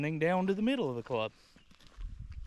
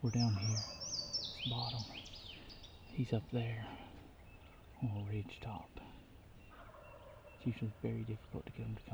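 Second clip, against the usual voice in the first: hushed hunter's whisper; 3 semitones lower, 9 dB quieter than usual. Real, not acted. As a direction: keep it down, bird nearby. Whispering while hunting turkey.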